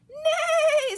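high, loud falling whinny; whinny like a horse, starting high; horse neigh. A narrator's reading. Horse greets the truck, loud high neigh. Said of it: "said a horse".

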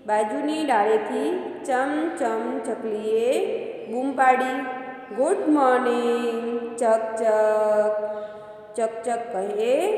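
slow, gentle storybook reading; unhurried children's story voice. A narrator's reading. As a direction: read it slowly like a bedtime tale for kids. Teacher reads children's story aloud.

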